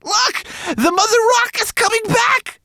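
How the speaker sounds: very high, panicky warning shout; alarmed cry. A narrator's reading. Twigleg warning of the returning mother rock, alarmed high cry. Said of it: "cried Twigleg, pointing ahead with trembling fingers".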